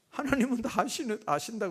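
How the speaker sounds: high, bemused reading aloud; missionary puzzled by the answer; incredulous reading. Acted. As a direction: read it in disbelief.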